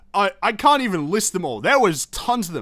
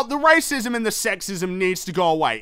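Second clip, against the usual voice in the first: ordinary delivery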